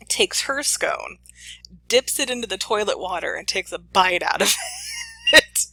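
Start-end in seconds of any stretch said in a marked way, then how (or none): 4.6-5.3 a high cry of shocked laughter; shocked shriek-laugh. Real, not acted. Host reacting to the mom's toilet scone, shrieking. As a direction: let out a disbelieving squeal.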